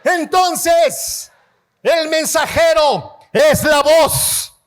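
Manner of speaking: fervent sermon delivery; preaching. Acted. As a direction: preach it out to the congregation.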